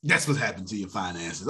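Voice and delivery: normal talking voice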